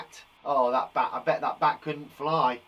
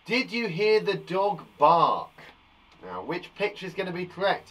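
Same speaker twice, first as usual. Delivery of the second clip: normal talking voice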